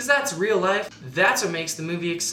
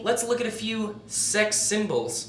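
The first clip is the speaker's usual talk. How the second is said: ordinary talk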